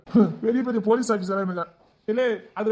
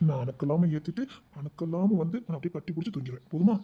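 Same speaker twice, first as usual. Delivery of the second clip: ordinary talk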